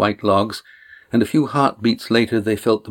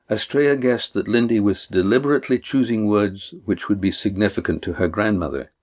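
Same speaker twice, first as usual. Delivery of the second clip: ordinary delivery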